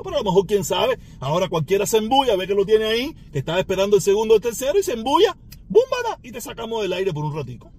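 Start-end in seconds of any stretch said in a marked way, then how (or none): 5.7-6.2 high loud burst of búmbara; mock crash sound. (¡búmbara! gritado agudo como un golpe)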